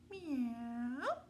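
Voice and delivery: high, drawn-out meow; cat meow. A narrator's reading. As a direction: meow like a cat, rising at the end. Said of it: "What does the cat say?".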